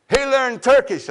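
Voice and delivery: loud high announcement; really loud. Retold or imitated. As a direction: blurt it out for everyone to hear. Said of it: "I said, really loud".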